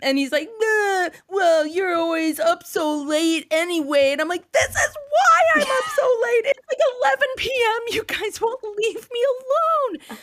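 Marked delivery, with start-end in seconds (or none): 0.6-4.1 higher, mocking kid voice; bratty retort in a put-on high voice; whining child. Imitates whining child talking back. 4.5-10.2 very high, loud hysterical wail; hammed-up shrieking meltdown; losing it. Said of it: "just about lost my fucking mind".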